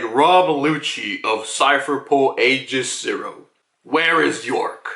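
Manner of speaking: slow, measured imitation of a demon. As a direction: intone it slowly as a giant demon. Voices giant bird demon questioning Lucci.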